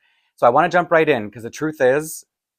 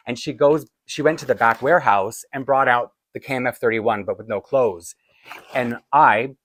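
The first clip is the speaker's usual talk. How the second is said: ordinary talk, nothing marked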